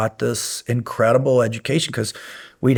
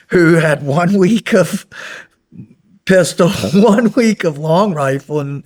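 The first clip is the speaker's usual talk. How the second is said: laughing; 6 semitones higher, 4 dB louder than usual. Real, not acted. Telling the host about untrained kids sent on raids, laughing. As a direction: chuckle through the absurd memory. Laughing at green young officers.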